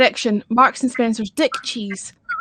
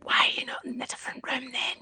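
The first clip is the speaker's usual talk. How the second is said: whispering; 12 dB quieter than usual. Real, not acted. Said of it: "are we whispering".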